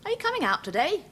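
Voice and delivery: high, quick calling mother voice; mother mouse calls her babies out. Acted. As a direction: call out brightly like a mother mouse. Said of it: "Mother says".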